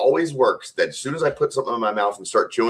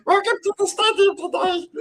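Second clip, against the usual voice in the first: very high squeaky announcer voice; helium voice. Pretend. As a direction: announce like you've inhaled helium. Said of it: "huffle helium before I announced it".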